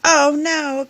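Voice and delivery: high, loud alarmed oh no; dismayed cry. Acted. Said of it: "Peppa cried".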